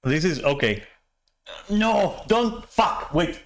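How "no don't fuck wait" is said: high alarmed no, don't; sudden panic as it drips; panicked outburst. Real, not acted. Ice cream starts leaking, panic.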